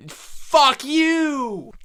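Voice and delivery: high, loud yelled curse; hammed-up loud yell; furious shout. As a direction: scream it at him. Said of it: "And he goes".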